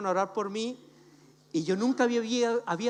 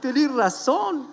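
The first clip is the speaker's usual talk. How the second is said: a lightly voiced old grandpa, higher and louder toward the end; forgetful grandpa; 7 semitones higher, 6 dB louder than usual. God answering like a forgetful grandpa, mild mock old-man voice. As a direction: play a doddering abuelito suddenly remembering. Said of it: "como si fuera un abuelito".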